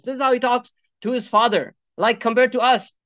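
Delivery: ordinary talk, nothing marked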